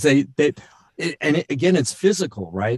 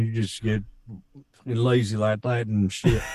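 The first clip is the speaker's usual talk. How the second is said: Texas accent. Shown as a demonstration. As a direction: drag it out in lazy Texan. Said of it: "But Texas is easy".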